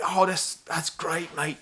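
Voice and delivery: hammed-up British accent for a quote; put-on British accent. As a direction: imitate a chummy British bloke. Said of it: "doing the British accent".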